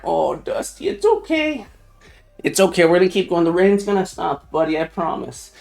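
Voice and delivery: soft, gentle promise to a frightened pet; calm the dog down softly; reassuring the dog. Real, not acted. Streamer promising his scared dog the rain will stop, soft and gentle.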